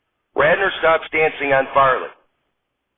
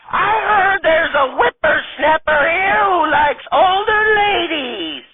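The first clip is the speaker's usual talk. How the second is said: high, slow, quavering granny voice; old lady voice; slower than usual. Acted. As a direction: play a sassy old grandma stripper.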